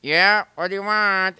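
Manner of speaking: high, loud answer from afar; Luigi answering. Retold or imitated. Luigi answers Mario's call from the other room, high and loud. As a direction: answer back a bit put out. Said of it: "So I'd have to go".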